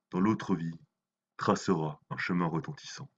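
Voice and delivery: solemn, measured poem reading; dramatic poem, phrase by phrase; poetry recitation. Acted. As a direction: recite the verse with weight, pausing between phrases.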